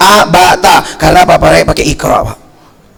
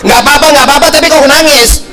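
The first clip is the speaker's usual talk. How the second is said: higher, insistent mock parent; imitating a disbelieving mother; mother's challenge; 6 semitones higher than usual. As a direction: throw the child's words back at them. Mother challenges the crying child.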